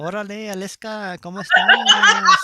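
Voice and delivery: put-on slurred, high Spanish accent; drunk Spanish accent. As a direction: play a tipsy guy greeting in Spanish. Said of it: "drunk Spanish accent".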